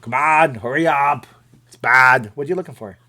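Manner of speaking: loud, put-on Austrian action-hero voice; punchy fake Austrian accent; bad Arnold impression. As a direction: do a hammy bad Schwarzenegger. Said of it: "I do a bad Arnold imp".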